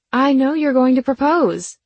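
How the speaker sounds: sudden excited outburst; blurted out. Acted. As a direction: blurt it out high and loud. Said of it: "I blurted out".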